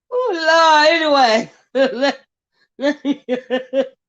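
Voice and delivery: high, crying-laughing words; laughing to tears. Real, not acted. To her viewers, half crying with laughter. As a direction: gasp it out through tears of laughter.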